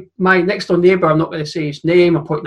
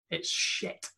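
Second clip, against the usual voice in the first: whispered punchline; 16 dB quieter than usual. Real, not acted. Dry whispered aside to the mates about empty stadiums. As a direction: drop it to a breathless whisper.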